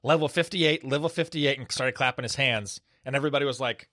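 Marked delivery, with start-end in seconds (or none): 0.0-1.6 retold yell, pitched up but no louder; mild mock yell. Doctor retells his friend breaking up a chaotic code, mock yell. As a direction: imitate a colleague's shout at half volume. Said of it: "he just yelled really, really loud".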